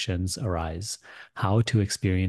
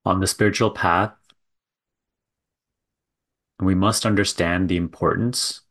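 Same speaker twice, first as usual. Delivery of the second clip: measured guiding delivery with long silences between phrases; contemplative spiritual teaching; 6 dB louder than usual. Real, not acted. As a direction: let each phrase land, then leave a long pause. Leads a contemplative talk.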